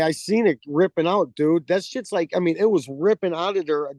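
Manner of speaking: usual speaking voice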